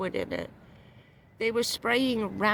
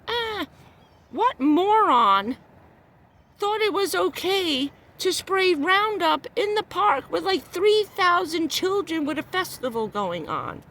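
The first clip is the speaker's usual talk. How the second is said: loud, indignant demand, higher; furious rhetorical question; outraged; 6 semitones higher, 3 dB louder than usual. Real, not acted. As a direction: let the outrage spill out loud. Outraged rant about park spraying.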